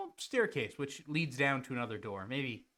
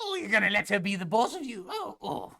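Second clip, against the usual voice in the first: teasing mud lady; 6 semitones higher, 4 dB louder than usual. Acted.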